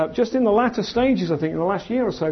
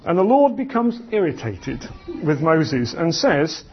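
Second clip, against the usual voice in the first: usual speaking voice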